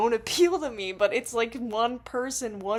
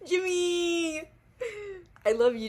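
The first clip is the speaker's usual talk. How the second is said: adoring fan squeal; 9 semitones higher than usual. Real, not acted. Fan showing off beloved [name], high adoring wail. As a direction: squeal the idol's name, stretched out.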